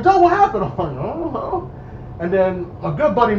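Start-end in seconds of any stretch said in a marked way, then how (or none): 0.0-0.6 high, loud imitation of onlookers; alarmed calling out. Imitating friends calling to him in the cuffs, alarmed shout. 1.0-1.7 small, high mock-helpless voice; high-pitched cluelessness. Retelling his answer to worried friends, high-pitched helplessness.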